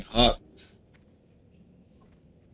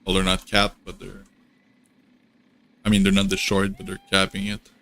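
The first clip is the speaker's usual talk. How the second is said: ordinary talk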